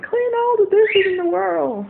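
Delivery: high, slow, nagging mom voice; mocking mom. Teen imitating her mom's orders, high slow mock nag. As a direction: stretch it out like a bossy mother. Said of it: "my mom was like".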